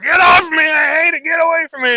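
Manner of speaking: high, loud yelling horse with a cry in it; panicked shout. Retold or imitated. Horse yelling at the trainer to get off, panicked. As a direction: scream it like a horse freaking out. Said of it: "Instead of like".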